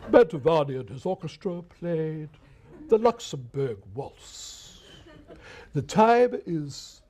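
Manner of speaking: slow, formal announcer read; drawn-out stiff announcement; lightly imitated old announcer, very slow; old radio announcer. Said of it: "the announcers used to speak that way".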